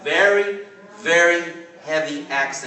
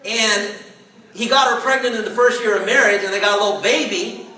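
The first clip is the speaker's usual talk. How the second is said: laughing; 5 semitones higher than usual. Retold or imitated. Friend describing broke kids, laughing.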